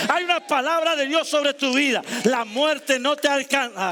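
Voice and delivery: full-force preaching. Acted. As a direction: preach it like a prophecy.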